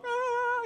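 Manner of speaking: high-pitched imitated wail of a crying kid; mock crying child. Young kid running to a parent, mock crying. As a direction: wail like a hurt little kid, hammed-up. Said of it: "they'd be crying".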